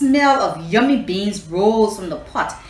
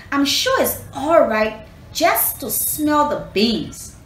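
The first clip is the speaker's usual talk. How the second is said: higher, slow, tempted; 6 semitones higher, slower than usual. Acted. Anansi to himself, drawn by the smell, slow and high. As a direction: savour each word, giving in. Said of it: "he said to himself".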